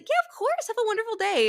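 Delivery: high sugary customer-service chirp; high, syrupy fake politeness; fake cheerful. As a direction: beam it out falsely sweet. Fake cheerful barista reply.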